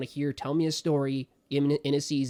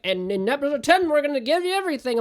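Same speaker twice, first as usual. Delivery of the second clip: much higher, louder, fast announcer tease; mock show promise; 12 semitones higher, 6 dB louder than usual. Mocking a show that saves everything for the finale, high and loud. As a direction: hype it like a cheesy promo. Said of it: "I can't get to episode nine and be like".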